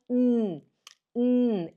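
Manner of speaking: "hum" as a held n, tongue on the palate; isolated, sustained n; N sound demo. Shown as a demonstration. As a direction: hum a long "nnn". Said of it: "Este é o som do N".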